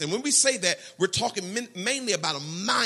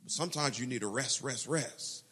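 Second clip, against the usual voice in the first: soft rest; 6 semitones lower, 8 dB quieter than usual. Acted. Soft contrast after hustle chant.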